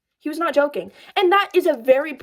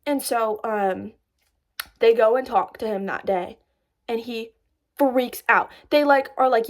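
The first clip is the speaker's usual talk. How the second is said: normal talking voice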